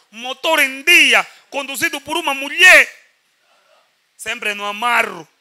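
loud, slow salesman spiel; car ad pitch. Acted. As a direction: sell the car like a radio ad. Mock car-sale advert pitch. (anúncio de venda de carro)

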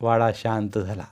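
ordinary delivery, nothing marked

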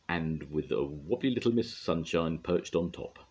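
ordinary delivery

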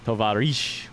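Tovarish said the Russian way, ee sound at the end. Shown as a demonstration. Streamer asks chat if Tovarish sounds Russian enough. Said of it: "Am I putting the emphasis on the right syllable?".

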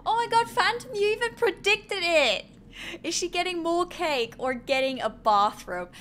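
loud, high, thrilled outburst; real excited yelling at a viewer; excited shout. Real, not acted. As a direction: shout it out, amazed the chat guessed right. Chat guessed the bathrobe.

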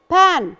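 بان as "pan", with short "a"; short vowel in بان. Shown as a demonstration. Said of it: "الفرق بين الشورت فاول واللونج فاول".

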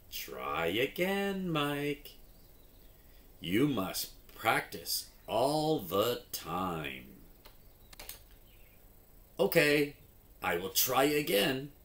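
lively storybook read with character voices; reading to children. Acted. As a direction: act out the story for young readers. Reads phonics story with voices.

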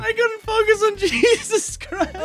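high, slow words through laughter; laughing fit. Real, not acted.

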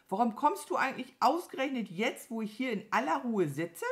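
genervte, hohe Krähenstimme; brummige Krähe. A narrator's reading. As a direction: sich mit hoher Stimme beschweren. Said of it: "brummte die Krähe". (genervt und vorwurfsvoll)

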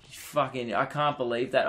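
normal talking voice

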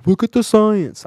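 high, loud sarcastic mimicry; mocking quote. Mocking what officials always say, high and loud. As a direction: sneer it in a put-on voice.

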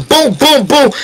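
high, rapid-fire punch noises; imitated punch booms. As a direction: voice each blow as it lands.

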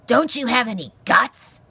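loud, exasperated porcupine; cantankerous cry. A narrator's reading. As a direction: demand it loudly, rising in pitch. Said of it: "Aerith cried".

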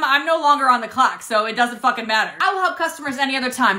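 usual speaking voice

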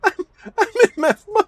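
high, loud words broken by laughter; laughing. Real, not acted. Player cracking up at RoboCop's antics, laughing. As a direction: barely get the words out through laughter.

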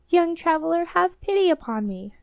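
high-pitched entreaty all through; pleading beggar woman. A narrator's reading. Old beggar woman appeals to gardener's son, high plea.

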